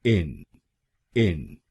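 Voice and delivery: इन demonstrated as its own syllable; first syllable alone. Shown as a demonstration. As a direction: isolate the syllable इन. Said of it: "पहले शब्दांश को यानी आईएन हम बोलेंगे".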